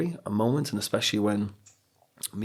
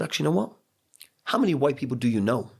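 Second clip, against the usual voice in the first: challenging teacher; 5 semitones higher, faster than usual. Retold or imitated.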